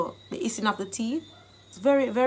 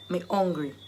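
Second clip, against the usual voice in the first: "hungry" said the Jamaican way, h dropped; low-key Jamaican Patois, falling on "hungry"; 6 semitones lower, 3 dB quieter, faster than usual. Shown as a demonstration. Teacher translating hunger for learners into Jamaican Patois. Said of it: "I've given you the Jamaican dialect".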